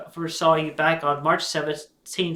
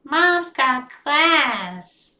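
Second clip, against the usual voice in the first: high, very slow admiring voice; drawn out, higher Crystal; Crystal's voice; 8 semitones higher, 5 dB louder, much slower than usual. Acted. Daughter admiring her mother, high slow voice. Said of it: "Crystal comments".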